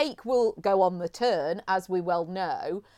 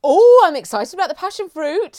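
excited; 6 semitones higher, 10 dB louder than usual. Real, not acted. Gushing over new flavour.

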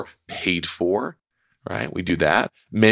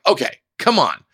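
frustrated; 6 semitones higher than usual. Retold or imitated. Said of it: "I'm, like, getting very frustrated".

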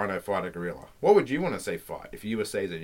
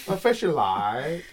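silly drawn-out echo; 6 semitones higher, 5 dB louder, much slower than usual. Pretend. Echoes the silly phrase.

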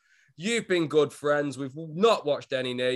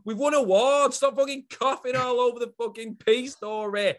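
mock rant; 7 semitones higher than usual. Mock rant at coughing friend.

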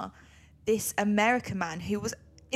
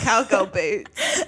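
slow, louder, breaking into laughter; laughing; 7 dB louder, much slower than usual. Real, not acted. To co-host, joking about cowgirl boots while laughing. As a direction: drag it out, cracking up.

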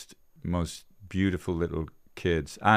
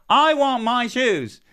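high, loud, drawn-out mock child's yell; demanding child; 9 dB louder, slower than usual. Imagined little girl demanding her shoes back, hammed-up yell. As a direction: holler it slowly like a demanding kid. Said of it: "she wouldn't go up to somebody".

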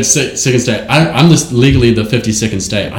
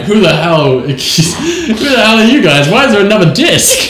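confused judge; 7 semitones higher than usual. Retold or imitated. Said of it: "the judge is like".

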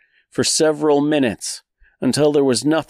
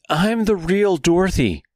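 high, urgent insistence; exclaiming; 7 semitones higher than usual. Acted. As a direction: cry it out, desperate. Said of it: "they exclaimed in unison".

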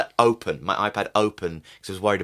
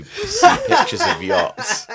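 laughing; 8 dB louder, slower than usual. Real, not acted. Joking laugh.

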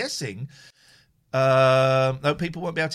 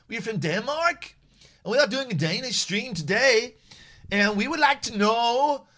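fake Danish accent; 6 semitones higher than usual. Fake Danish phone-in host.

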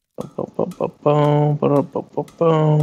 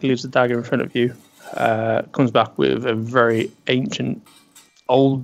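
ordinary talk, nothing marked

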